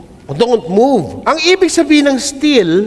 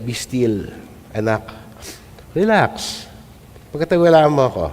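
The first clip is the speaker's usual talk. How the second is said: gentle voice of God; 10 semitones lower, 6 dB quieter than usual. Acted. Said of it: "ito yung sinabi ni Lord".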